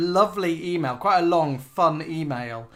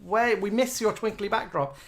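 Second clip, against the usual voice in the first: gushing fans; 6 semitones higher, 4 dB louder than usual. Retold or imitated. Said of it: "They all said".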